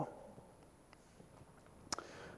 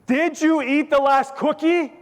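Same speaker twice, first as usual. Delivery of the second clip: louder, higher, slowed-down question; repeated louder; 12 semitones higher, 5 dB louder than usual. Shown as a demonstration. Asking a second time after disliking the answer, louder. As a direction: ask it loudly and slowly, as if not heard. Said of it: "You just say it again louder".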